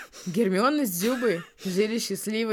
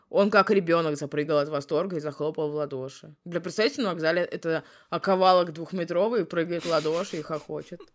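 normal talking voice

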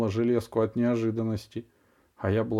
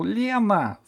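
high, loud shout of a name; yell; 11 semitones higher, 3 dB louder than usual. A narrator's reading. Dentist yells for her nurse. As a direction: bellow for help from the next room. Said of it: "заорала врачиха".

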